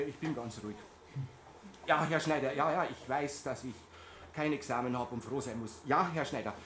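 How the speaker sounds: low, soft, beaten-down yeses; a carer meekly answering his boss; resigned mumbling. Acted. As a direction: swallow the anger and give in. Muttering submission to boss.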